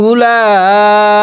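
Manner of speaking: উলাইকা with লা held four beats; four-alif stretch. Shown as a demonstration. Teacher demonstrates the long লা in উলাইকা. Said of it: "লামের মধ্যে আমরা চার আলিফ টেনে পড়ব".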